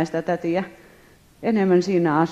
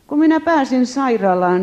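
ordinary delivery

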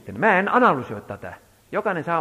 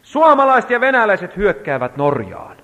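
higher and louder proclaiming; child's prophetic shout; 6 semitones higher, 10 dB louder than usual. Retold or imitated. Father voicing his son's night prophecy, loud strange voice. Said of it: "hän rupesi huutaa sieltä".

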